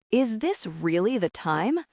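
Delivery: ordinary delivery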